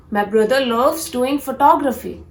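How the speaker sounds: ordinary delivery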